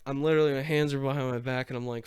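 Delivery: usual speaking voice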